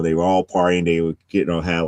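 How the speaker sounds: ordinary talk, nothing marked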